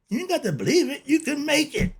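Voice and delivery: laughing. Real, not acted. Telling listeners they can practice it, laughing. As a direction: chuckle it out, amused.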